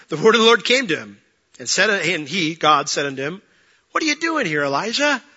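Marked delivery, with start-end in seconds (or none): 3.9-5.2 high-pitched pointed question. Acted. God questioning the runaway prophet, high-pitched challenge. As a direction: ask it high, falling then lifting.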